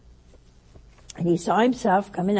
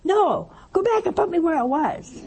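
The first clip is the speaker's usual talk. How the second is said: high-pitched, hammed-up angry demand; mad; 9 semitones higher, 3 dB louder than usual. Preacher voicing revived patient ordering doctor, mad. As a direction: protest indignantly as the retold patient. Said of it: "And he was so mad".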